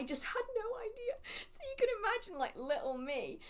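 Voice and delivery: high squeaky laugh through the words. Real, not acted. Laughing at herself after misreading a couple's reading. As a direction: giggle it out, embarrassed. Laughing at her own cluelessness.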